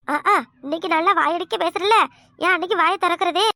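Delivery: normal talking voice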